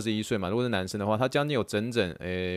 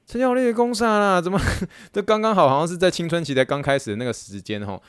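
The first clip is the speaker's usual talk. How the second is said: high, incredulous inner voice; what are you even saying, coach; exasperated self-talk; 6 semitones higher than usual. Retold or imitated. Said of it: "我就想说".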